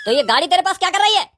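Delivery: furious policeman yelling at a rider with no papers; angry shout. Acted. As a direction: shout him down like a stern cop. Policeman scolding caught scooter riders.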